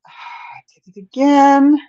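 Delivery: high, slow sighing groan; exasperated sigh. Real, not acted. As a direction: sigh it out, fed up. Sighs at repeated mistake.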